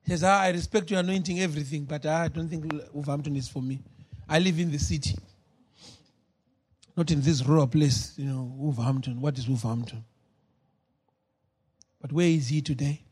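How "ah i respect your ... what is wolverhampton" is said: lower, softer, sniffy tone; mock the city snob dismissing the town; scornful young man. Lovemore mocked as dismissive.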